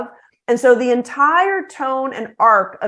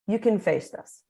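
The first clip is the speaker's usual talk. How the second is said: softer, steady and sincere; reassuring; 4 semitones lower, 5 dB quieter than usual. Shown as a demonstration. To someone facing something difficult, softer and sincere. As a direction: lend them your strength quietly. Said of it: "the magical phrase of".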